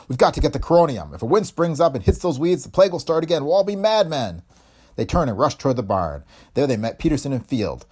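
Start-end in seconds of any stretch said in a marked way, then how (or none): 0.1-4.4 high, pressing warning; urgent cry. A narrator's reading. Professor warning of plague if wind rises, urgent cry. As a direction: raise the pitch and press the danger home. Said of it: "Calthay cried".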